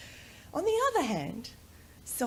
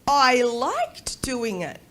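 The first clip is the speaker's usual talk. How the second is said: put-on louder, slow, proud child's answer; imitating her son; 2 semitones higher, 8 dB louder, much slower than usual. Mother voices son's answer, louder proud child. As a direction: declare it like a proud little boy.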